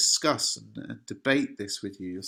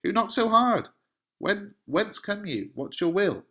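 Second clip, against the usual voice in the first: high, loud call to the door; panicking; 11 semitones higher, 5 dB louder than usual. Acted. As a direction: demand who is knocking, in acted alarm. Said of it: "is panicking".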